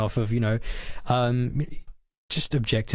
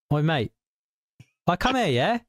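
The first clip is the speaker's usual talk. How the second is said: a hint of a London chav, rising on the second call; chav accent; 6 semitones higher than usual. Friend asked to imitate a chav, a light chav accent. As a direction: lightly ham up a chav calling out. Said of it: "I guess it would be like".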